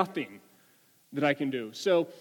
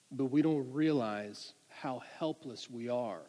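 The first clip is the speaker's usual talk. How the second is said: soft, lowered, sober sermon voice; reflective preaching; 4 semitones lower, 7 dB quieter than usual. Real, not acted. Preacher telling congregation about feeling helpless, softly reflective. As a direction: bring the room down to a quiet, serious point.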